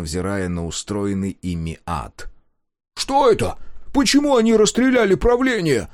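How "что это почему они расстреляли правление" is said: high, loud panicked shout. A narrator's reading. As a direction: yell over the screaming crowd. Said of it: "закричал Дозер".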